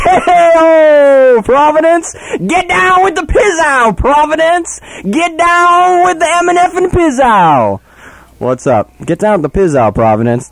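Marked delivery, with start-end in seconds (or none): 0.0-2.1 high, hyped shouting street performer; street musician impression. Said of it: "That's actually a quote from street musician". 2.4-3.9 high, slow hyped busker shout; street musician impression. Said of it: "That's actually a quote from street musician". 4.0-7.8 high, slow hyped busker shout; street musician impression. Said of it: "That's actually a quote from street musician".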